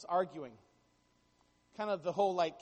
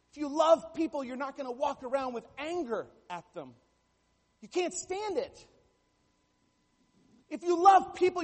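louder, higher preaching build on each if you love people; 5 semitones higher, 4 dB louder than usual. Acted. Pastor urging the congregation to love people, full preaching. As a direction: drive the refrain home to the crowd.

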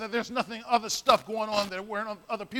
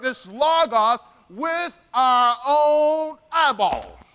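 slowed, loud, every word pressed; slow emphatic testimony; 4 dB louder, slower than usual. Acted. Preacher voicing John to the church, slow and pressing. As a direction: hammer home the eyewitness claim. Preacher acting John's insistence.

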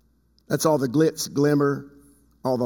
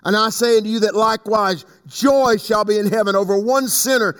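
raised, declamatory Bible reading; preached scripture reading; 5 semitones higher, 3 dB louder than usual. Acted. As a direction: proclaim the verses to the congregation. Reads scripture aloud, preaching.

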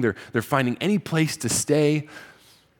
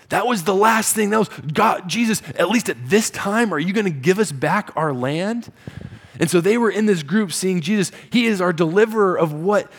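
raised, loud and high, driving the point home; preaching at full force; 6 semitones higher, 4 dB louder than usual. Acted. Preacher pressing the disciples' hope on the congregation, loud and high. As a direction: preach it hard to the room.

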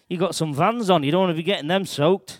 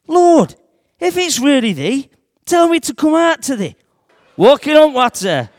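higher, loud call across the water; 7 semitones higher, 7 dB louder, slower than usual. Acted. Peter calling to Jesus across the stormy lake, loud and high. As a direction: shout out to Jesus over the waves. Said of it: "So I says".

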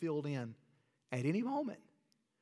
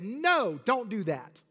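raised, firm forbidding; firm warning; 5 semitones higher, 6 dB louder, slower than usual. Acted. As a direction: forbid it firmly and loudly. God voiced forbidding.

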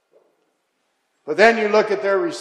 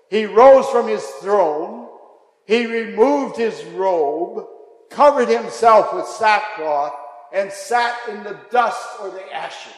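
slow and deliberate; 2 semitones higher, 5 dB louder than usual. Shown as a demonstration. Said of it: "It's so descriptive for us".

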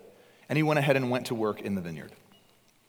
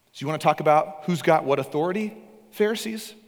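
higher, louder fiery challenge to the Pharisees; preaching at full force; 6 semitones higher, 4 dB louder than usual. Acted. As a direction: confront them from the pulpit, building.

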